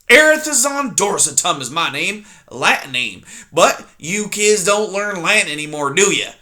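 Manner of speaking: put-on loud, grumbling old porcupine; loud cranky old porcupine; grumpy old porcupine. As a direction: snap at the intruder like a cranky old coot. Erith voiced in story.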